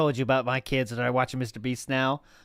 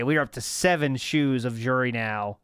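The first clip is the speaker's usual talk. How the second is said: normal talking voice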